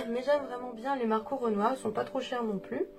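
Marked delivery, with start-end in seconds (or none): none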